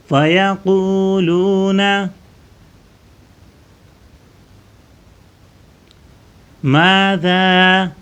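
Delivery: melodic recitation, drawn out; Quran recitation. Acted. Teacher recites the verse for pupils, melodic chant.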